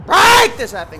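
sudden loud burst of frustration; angry outburst. Real, not acted. Gamer raging at his failing controller, loud angry outburst.

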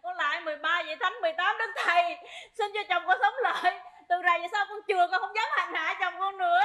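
prayer said out clearly, quickened; speaking up. Acted. Wife praying so the dead can hear, speaking up. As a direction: plead up to the saints in full voice. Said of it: "mày nói lớn lớn lên".

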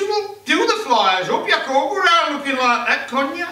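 high, loud scolding; nagging wife. Acted. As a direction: chivvy him into his clothes. Wife nags husband dressing.

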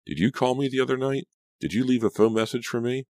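high, pressing questions; urgent questioning. A narrator's reading. Narrator pressing Laura before she hangs up, urgent. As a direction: fire questions at her, pitched high.

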